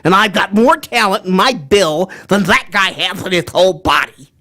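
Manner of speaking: boastful cartoon duck with stretched-out words; Daffy Duck. Voice actor introduces Daffy, boastful cartoon duck. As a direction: brag like a jealous cartoon duck. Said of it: "I am Daffy Duck".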